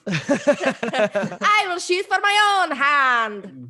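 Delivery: slow, hammed-up Scottish brogue; Scottish accent. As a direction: drag it out in a bad Scottish accent. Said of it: "such a hard accent for me to do".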